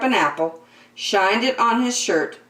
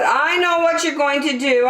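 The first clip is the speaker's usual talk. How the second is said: put-on knowing child; 8 semitones higher, 3 dB louder than usual. A narrator's reading. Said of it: "Andrew said".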